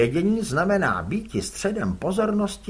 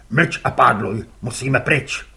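louder gruff curt reply; gruff father; 4 semitones lower, 7 dB louder than usual. Acted. Father answers his son curtly, gruff.

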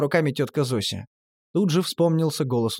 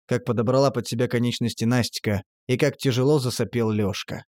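ordinary delivery, nothing marked

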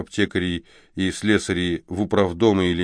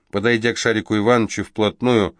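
usual speaking voice

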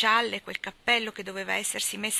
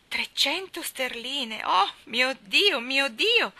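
slow, loud, higher dismay; shocked outcry; 6 semitones higher, 8 dB louder, much slower than usual. A narrator's reading. Wife hearing of the hidden debt, loud slow outcry. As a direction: wail at the news in disbelief. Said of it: "disse missis Tulliver".

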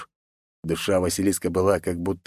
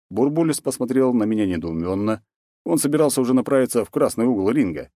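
plain reading voice

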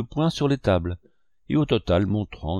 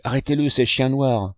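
mild higher-pitched cry of alarm; 4 semitones higher, 3 dB louder than usual. A narrator's reading. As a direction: call out to have someone stopped. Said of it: "m'écriai-je". (cri d'alerte modéré)

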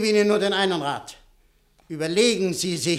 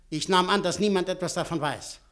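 ordinary delivery

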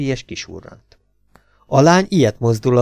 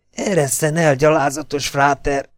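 higher, restrained indignant hiss; 4 semitones higher than usual. A narrator's reading. Girl pinned down by Bobby, indignant hiss. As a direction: hiss the insult without raising the volume. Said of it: "sziszegte a lány".

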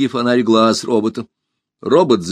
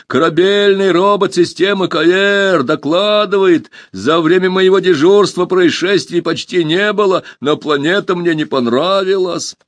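high, loud, measured robot report; 9 semitones higher, 4 dB louder than usual. A narrator's reading. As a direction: recite the report like a proud machine.

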